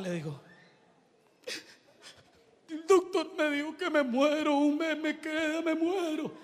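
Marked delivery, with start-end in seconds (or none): 2.7-6.3 acted dying girl. Dying girl tells preacher the doctor's verdict, acted distress.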